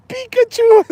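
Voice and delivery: very high, loud cartoon-creature cry; Pikachu. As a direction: squeal it like a tiny battle monster. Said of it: "You know the Pokemon".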